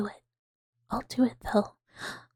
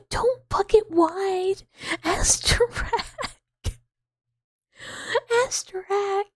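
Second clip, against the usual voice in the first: high, lively character voice answering chat; 7 semitones higher, slower than usual. Acted. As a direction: stay in the bright raised voice.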